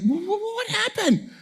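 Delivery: high, stammering alarmed wife; wife rushing out, stumbling over her words in shock; startled wife. Retold or imitated. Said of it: "Lisa's like".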